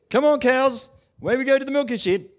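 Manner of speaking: farmer calling cows. Retold or imitated.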